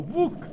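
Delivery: 1 stubbed-toe curse. Acted. Man stubs his toe.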